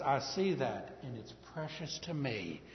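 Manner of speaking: low, soft reassuring voice; tender God. Pretend. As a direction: comfort the broken sinner gently. God comforting repentant sinner.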